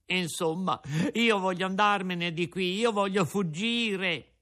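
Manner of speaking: high puppet voice, worried; a scared wooden puppet protesting; Pinocchio. A narrator's reading. Pinocchio character voice. (burattino spaventato, voce alta)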